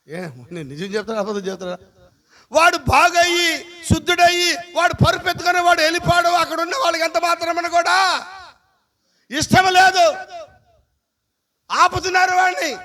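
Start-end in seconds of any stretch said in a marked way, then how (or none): 2.5-12.7 higher, louder, emphatic sermon voice; fervent preaching. Acted. Pastor telling the healing story to the church, fervent preaching.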